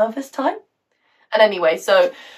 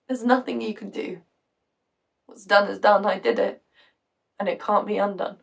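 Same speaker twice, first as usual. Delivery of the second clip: soft, subdued admission of blame; resigned guilt; 4 dB quieter than usual. Acted. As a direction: say it quietly, with no hope left. Acts mother's resigned guilt.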